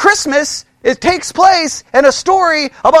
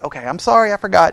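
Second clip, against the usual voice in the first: ordinary talk, nothing marked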